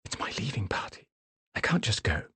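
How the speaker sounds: breathy hushed plea to stay; a wife hushing her husband at her own party; whisper. Acted. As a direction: keep it under your breath so the room can't hear. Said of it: "She whispered".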